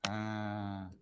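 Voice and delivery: normal talking voice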